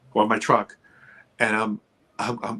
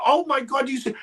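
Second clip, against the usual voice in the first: awestruck cry; 13 semitones higher than usual. Retold or imitated. Retold awestruck call again.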